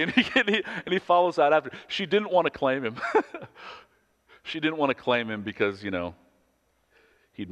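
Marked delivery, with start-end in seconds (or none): 3.0-3.7 laughing high at own story; a real, high laugh; high burst of laughter. Real, not acted.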